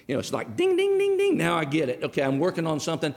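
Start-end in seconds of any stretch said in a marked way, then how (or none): 0.6-2.0 high sing-song ding ding. Retold or imitated. Preacher mimics a light coming on, high chime. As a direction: chime it like a bell going off. Light-bulb moment sound effect.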